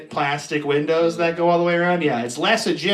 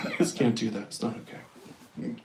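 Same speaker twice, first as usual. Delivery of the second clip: fading to a soft, slow whisper; whispered limp warning; feeble wizard; 6 semitones lower, 10 dB quieter than usual. Retold or imitated. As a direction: let it die away meekly. [name]'s feeble version of Gandalf.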